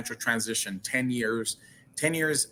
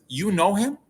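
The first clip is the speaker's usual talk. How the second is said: amazed question; 7 semitones higher, 3 dB louder than usual. Retold or imitated. Stranger's amazed question, retold.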